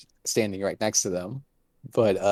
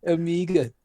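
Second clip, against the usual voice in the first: higher-pitched mock Kiwi "Omeega"; New Zealand accent; 7 semitones higher than usual. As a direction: imitate a New Zealander saying Omega, pitched up. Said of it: "have a New Zealand accent".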